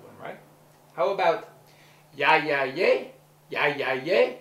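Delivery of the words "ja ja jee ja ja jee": loud "Ja, ja, jee" in Dutch for a learner; Dutch phrase demo. Shown as a demonstration. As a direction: call out "Ja, ja, jee" loudly as a Dutch model phrase. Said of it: "give A a little Dutch lesson".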